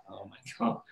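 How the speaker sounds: soft low stunned words; horrified murmur. Retold or imitated. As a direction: breathe it out in shock. Shocked inner reaction.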